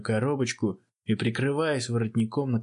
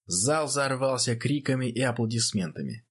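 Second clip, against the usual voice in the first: ordinary delivery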